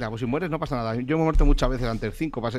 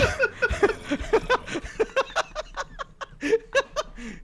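long high-pitched laughing fit; helpless laughing fit; 3 dB quieter than usual. Real, not acted. Laughing at a friend's covered face, helpless. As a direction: crack up uncontrollably.

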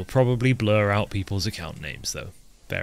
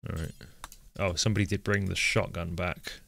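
normal talking voice

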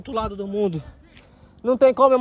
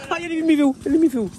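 being chased; 6 semitones higher than usual. Real, not acted. Spotted by the killer.

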